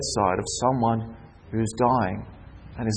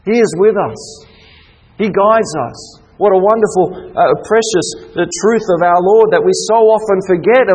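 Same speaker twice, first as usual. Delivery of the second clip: raised, ringing preacher voice; fervent preaching; 6 semitones higher, 5 dB louder than usual. Acted. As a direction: proclaim it to the congregation. Preaching at full force.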